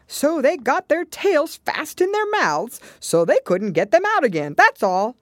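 high, loud, emphatic creature storyteller; Gryphon. Acted. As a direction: build it up loudly, then end abruptly.

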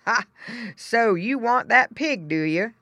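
amused farmer. Acted. Farmer to boy wanting the pig, amused. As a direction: draw out the question to a child.

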